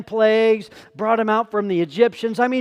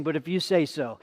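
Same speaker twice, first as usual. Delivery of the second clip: softer surrender; 6 semitones lower, 8 dB quieter than usual. Shown as a demonstration. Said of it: "I want you to start facing".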